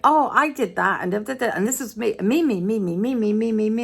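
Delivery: lightly voiced self-important woman; mocking a show-off. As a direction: send up her me-me-me. Mocks a self-centred woman.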